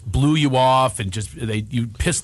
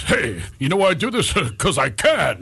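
Rodney Dangerfield; 2 semitones higher than usual. Retold or imitated. Comedian caught backstage confides to visitors, Rodney Dangerfield impression. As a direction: imitate a wasted old-school comic. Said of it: "The day I met Rodney Dangerfield".

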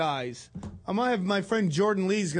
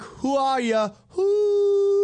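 shouting; 13 semitones higher, 4 dB louder, much slower than usual. Acted. Radio host greeting a caller.

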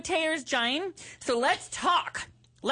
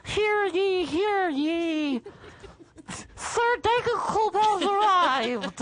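town crier; 6 semitones higher, much slower than usual. Pretend. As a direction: announce it like a medieval herald. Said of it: "Hold on, ready? Ready?".

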